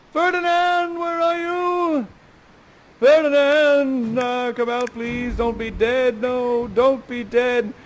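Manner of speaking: high, loud, drawn-out calls for Ferdinand; calling out. Acted. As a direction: wail for the lost son across the island.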